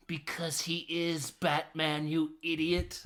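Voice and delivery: slow, drawn-out character voice; mock retort. Answering why Bruce is dressed up, slow mock retort. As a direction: spell it out slowly as an imitation. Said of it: "He's like".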